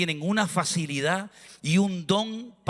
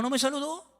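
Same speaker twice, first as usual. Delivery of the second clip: higher, rising whine on "saludó"; mock whiny repeat; 7 semitones higher than usual. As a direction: echo the complaint like a sulking child. Mimicking an offended churchgoer.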